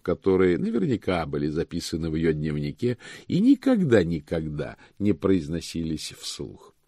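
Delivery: usual speaking voice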